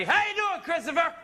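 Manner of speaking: high, hearty dad greeting; partying dad. Retold or imitated. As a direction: imitate a merry father calling out hello. Dad arrives from Christmas party.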